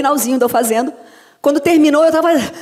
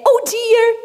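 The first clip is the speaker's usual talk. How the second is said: high, cute cartoon voice; Minnie; 12 semitones higher than usual. Dubber showing her character's English catchphrase, high cartoon voice. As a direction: lift it into a squeaky cartoon pitch. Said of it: "A Mini fala demais".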